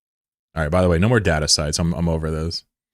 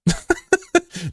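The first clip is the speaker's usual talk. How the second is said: high-pitched staccato laugh; high giggle. Real, not acted. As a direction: burst into a quick high laugh. Laughs at his own remark.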